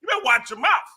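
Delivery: high, fast, laughing mock retort; a lightly hammed-up comic comeback through laughter; Bernie Mac style retort. Replaying his retort to the supervisor, high, fast and laughing. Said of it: "I think I did Bernie Mac on him".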